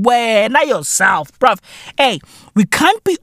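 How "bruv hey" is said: ordinary talk, nothing marked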